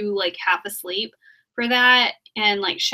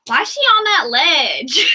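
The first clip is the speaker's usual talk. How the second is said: mock exasperated shout; 5 semitones higher, 7 dB louder, slower than usual. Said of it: "I was like".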